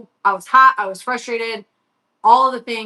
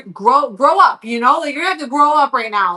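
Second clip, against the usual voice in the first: louder, higher retold telling-off; stern scolding; 4 semitones higher, 7 dB louder than usual. Mom pushing son who complains about heat, stern scolding. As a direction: lean hard and loud on the scolding.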